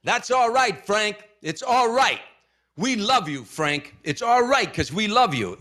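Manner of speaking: high, loud call from the back of the arena; hollered out. Retold or imitated. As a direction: shout it up across the crowd. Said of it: "he hollered out".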